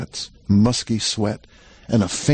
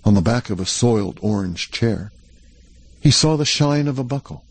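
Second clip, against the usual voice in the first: ordinary delivery, nothing marked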